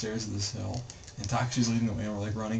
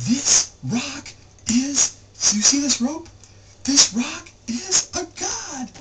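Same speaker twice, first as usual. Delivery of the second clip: high, loud and slow, building to god; mimicking Takashi's excited exclamation; 9 dB louder, slower than usual. Friend telling the group the rock is a god, high and loud. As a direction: announce it as sacred truth, wide-eyed. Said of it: "And he goes".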